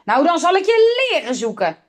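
high-pitched schoolmaster's threat; storybook angry shouting, not full volume; angry yell. A narrator's reading. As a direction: raise the pitch in a teacher's outburst. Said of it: "schreeuwde de meester".

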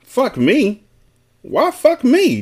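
high-pitched, offended comeback; incredulous question. A narrator's reading. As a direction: throw it back in high-voiced disbelief. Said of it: "Daylo asked".

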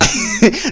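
high burst of laughter; risa contenida que estalla. Acted. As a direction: burst out laughing at the patient's remark. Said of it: "disculpe que me ría". (carcajada que se escapa)

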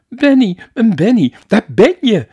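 very high, very loud shriek of relief; a mother screaming her son's name. A narrator's reading. A mother shrieking on finding her lost son. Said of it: "naar zijn gillende moeder".

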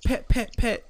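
high little mouth-made shots; short high popping shots; new-rapper gunshot ad-lib. Retold or imitated. Showing new rappers' gunshot sounds, high ad-lib pops. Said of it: "these new rap".

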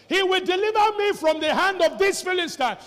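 loud, high declaration of victory; fiery sermon shout; defiant shout. Acted. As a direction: proclaim victory to the crowd. David's defiant vow.

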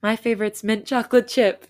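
ordinary delivery

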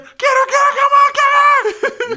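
very high, loud mock crowd screech; frantic cheering. Crowd watching the girls swing, frantically cheering. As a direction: imitate spectators screaming them on.

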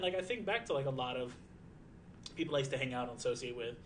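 normal talking voice

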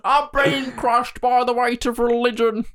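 high, slow strained mock voice; hammed-up Jesus. Joking as Jesus under the cross, hammed-up strain. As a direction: groan it out as a burdened Jesus.

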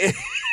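a thin, whistling laugh up high; high squeaky giggle. Real, not acted. Cracking up at the dumb text, squeaky high giggle. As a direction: let a squeaky laugh slip out.

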